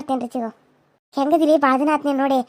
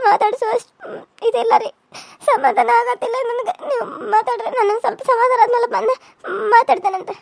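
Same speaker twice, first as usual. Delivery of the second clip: higher, tearful broken sentences; crying; 5 semitones higher than usual. Acted. Daughter-in-law asks the elders to let her be, crying. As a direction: sob while begging to be left alone. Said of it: "ಅತ್ಕೊಂತ ಹೊಂಟಿದಿ".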